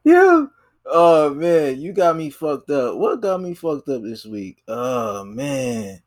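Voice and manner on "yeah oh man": catching breath after a laughing fit; after laughing hard. Real, not acted. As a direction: still recovering from laughter. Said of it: "Hold, let me collect myself".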